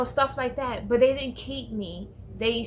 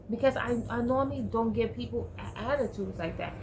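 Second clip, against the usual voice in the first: usual speaking voice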